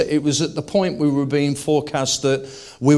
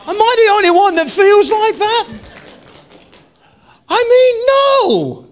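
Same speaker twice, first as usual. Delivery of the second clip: impassioned shouting; 7 dB louder than usual. Real, not acted.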